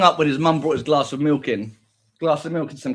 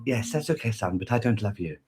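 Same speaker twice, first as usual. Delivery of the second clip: cold mother; 5 semitones lower, 5 dB quieter than usual. Pretend. Mother rejects son.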